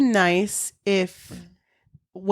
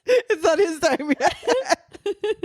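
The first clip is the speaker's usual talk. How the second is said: mock dramatic; 9 semitones higher, much slower than usual.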